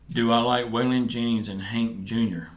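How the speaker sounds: ordinary delivery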